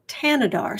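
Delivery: "Tanadar" said with a plain T, no th; silent-H pronunciation. Shown as a demonstration. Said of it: "it's a, a silent H".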